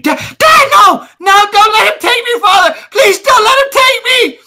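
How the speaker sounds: high, loud desperate screams of a daughter; terrified screaming girl. Acted. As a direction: shriek for your father to save you. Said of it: "She's screaming".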